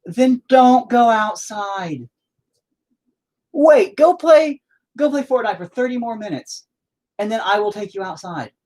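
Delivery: fed-up scolding. Real, not acted. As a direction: lay down the law, losing patience. Scolding the kid at door.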